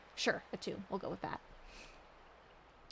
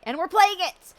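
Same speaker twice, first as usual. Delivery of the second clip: excited; 8 semitones higher, 15 dB louder than usual. Real, not acted. Excited streamer greeting viewer.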